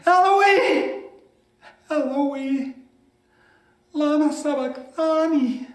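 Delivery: high-pitched cry, fading after the first word; loud anguished cry. A narrator's reading. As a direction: call out high, then let the volume fall away. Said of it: "cried out with a loud voice".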